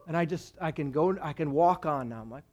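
ordinary talk

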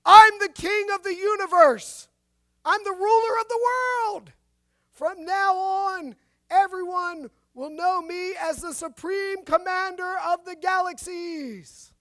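high, booming grandiose shout; slowing into a loud royal proclamation; shouting madman. A narrator's reading. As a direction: bellow it like a lunatic who thinks he rules the world. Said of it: "he heard shouting from one of the cells".

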